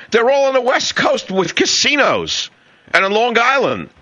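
mocking disbelief. Real, not acted. Mocking the Russian analyst's claim.

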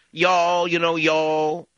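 high, stretched mock Southern twang; fake Southern accent. Host mocking actresses who attempt the South, fake Southern accent. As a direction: put on a badly faked Southern accent, drawn out. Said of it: "try to put on a Southern accent".

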